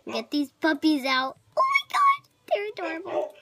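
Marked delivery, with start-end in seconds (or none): 1.6-3.3 delighted squeal. Real, not acted. Seeing the freed puppies.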